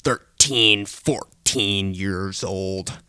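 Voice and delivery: normal talking voice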